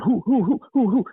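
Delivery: imitating her high owl-like hoots; a retold woman hooting like an owl. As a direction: hoot each "who" up high like an owl. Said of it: "she sounded like an owl".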